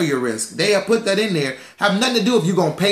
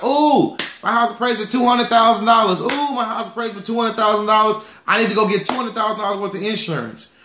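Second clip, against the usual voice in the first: mock excited homeowner; 6 semitones higher than usual. Mocking homeowners excited about appraisal.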